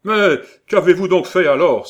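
loud, high exasperation; impatient cry. A narrator's reading. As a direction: shout it out, all patience gone. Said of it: "s'écria le geôlier, impatienté". (exclamation impatientée)

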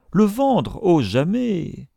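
high outcry, drawn out at the end; horrified refusal. A narrator's reading. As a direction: recoil from the very idea. Miser horrified at selling. (refus horrifié)

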